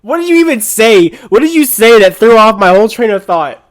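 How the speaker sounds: loud, exasperated questioning. Real, not acted. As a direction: demand it, half laughing. Demands to know what derailed him.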